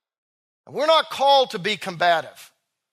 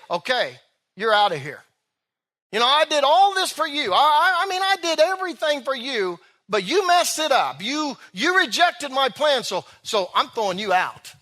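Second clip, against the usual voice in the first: mock angry God; 4 semitones higher than usual. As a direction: parody an offended God venting. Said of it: "He didn't get mad ... and say".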